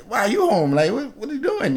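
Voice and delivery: high, rising mock-innocent questioning of mother; retold anxious question. Son to his mother, high nervous questioning.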